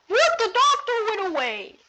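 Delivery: very high squeaky disbelief; astonished. Pretend. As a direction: shriek in astonishment. Doctor vanishes, disbelief.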